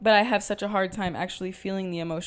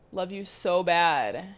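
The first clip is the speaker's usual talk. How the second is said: slow, stretched playful love you; slowly hamming up the goodbye; drawn out; much slower than usual. Real, not acted. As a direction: linger on every word. Drawn-out repeat of sign-off.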